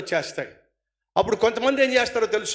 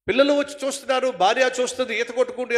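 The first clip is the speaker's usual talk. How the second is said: ordinary talk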